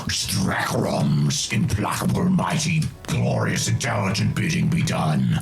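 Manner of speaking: low, slow demonic roar. Acted. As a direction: intone it like a possessed demon. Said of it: "in a demonic voice".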